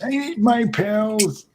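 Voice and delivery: put-on old man voice. Quoting frail Simpsons grandpa.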